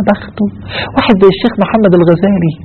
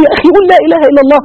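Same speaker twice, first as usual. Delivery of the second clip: much higher and very fast, pleading; urgent plea; 12 semitones higher, 4 dB louder, much faster than usual. Retold or imitated. Friend urgently pleading with the dying passenger, fast and high. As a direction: rush the plea, high and desperate. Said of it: "أنا قلت له".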